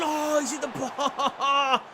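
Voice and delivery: slow, high, loud groan of disbelief; dismayed cry. Real, not acted. Gamer reacting to the crossbar, loud and stunned. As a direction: stretch it out as the ball hits the bar.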